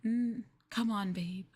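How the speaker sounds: ordinary talk, nothing marked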